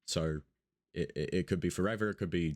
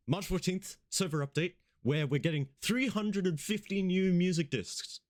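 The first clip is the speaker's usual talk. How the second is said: excited; 10 semitones higher than usual. Real, not acted. Excited server update announcement.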